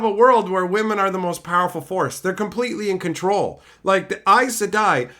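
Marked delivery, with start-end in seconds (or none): none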